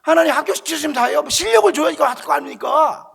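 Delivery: high, loud, indignant plea; shouted demand. Retold or imitated. Student demanding real ability from God, shouting. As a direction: yell it at heaven, retelling. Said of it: "그래서 막 소리를 치는 거야".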